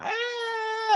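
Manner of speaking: very high, thin cry of pain; mock pained cry. Crewman feeling the creature inside him, high mock pained cry. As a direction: let out a high, hammed-up yelp of pain. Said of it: "he starts heeing and hawing".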